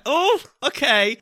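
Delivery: high, drawn-out nervous acceptance. Retold or imitated. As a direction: retell it as a slow, squeaky-high reluctant agreement. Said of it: "I was quite nervous".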